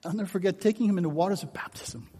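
ordinary talk

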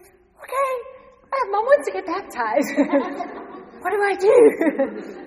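high and loud, laughing through the words; bubbling over with nervous delight, half laughing; excited laughing call. Retold or imitated. Phoning her sister about mom's baptism, laughing excitedly.

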